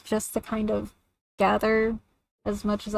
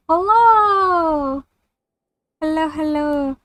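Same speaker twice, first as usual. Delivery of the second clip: Hello! squeaked far higher and louder; shrill sing-song hello, pitched way up; 7 semitones higher, 7 dB louder, much slower than usual. Shown as a demonstration. Streamer showing chat the high voice she must quit, very high. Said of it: "my high-pitched voice".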